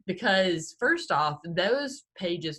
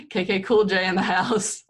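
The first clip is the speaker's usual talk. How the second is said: loud, playful hype-man shout-out; mock hype announcer; 3 semitones higher, 8 dB louder than usual. Co-host jokes about a show opener, mock hype. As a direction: announce the nickname like a party MC.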